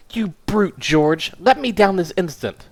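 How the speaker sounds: high, demanding woman's voice; indignant wife. Acted. Wife on the pedestal demands her husband release her. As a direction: order him to let her down.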